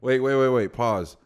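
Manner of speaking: ordinary talk, nothing marked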